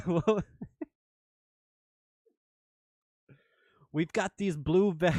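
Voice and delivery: hammed-up amateur British accent; attempted British accent. Shown as a demonstration. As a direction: put on a shaky-grip British accent that drifts Australian. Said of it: "my British accent sounds Australian".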